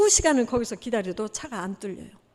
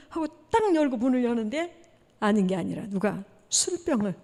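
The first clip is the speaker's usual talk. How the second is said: normal talking voice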